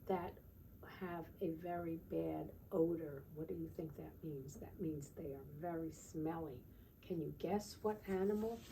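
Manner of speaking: ordinary talk, nothing marked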